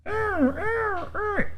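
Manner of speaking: high wailing alarm beeps; imitated alarm. Storyteller imitating the building's smoke alarm, high wail.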